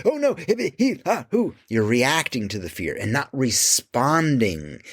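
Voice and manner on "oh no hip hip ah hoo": mock panic breaths, much higher; hammed-up hyperventilating gasps; panicked gasping. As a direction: pretend freaking out, gasping high. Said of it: "You're like".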